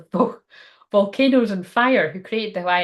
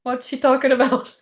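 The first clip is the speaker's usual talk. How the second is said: high, loudish mock disbelief; pitched-up comic outburst; imagined startled viewer; 5 semitones higher, 4 dB louder than usual. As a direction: play a viewer suddenly baffled and appalled. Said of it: "and it's like".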